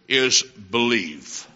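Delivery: believe drawn out slow and low; slow stress on believe. Real, not acted. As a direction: lean hard on believe, slowly. Preacher stresses the key word.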